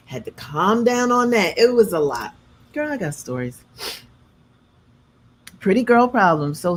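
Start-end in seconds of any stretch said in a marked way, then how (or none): none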